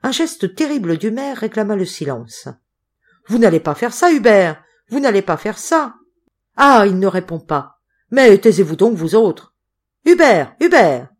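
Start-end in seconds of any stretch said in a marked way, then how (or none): 3.3-6.0 loud, raised-pitch plea; alarmed protest. A narrator's reading. Mayor pleads loudly through shutter. 6.6-11.1 loud, exasperated hushing and calling; agitated shouting. A narrator's reading. Mayor shouts over panicked room.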